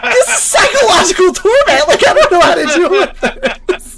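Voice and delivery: high-pitched, laughing all through; laughing complaint. Retold or imitated. Dorm chief complaining about the torment, laughing. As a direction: protest while cracking up. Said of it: "and I was like".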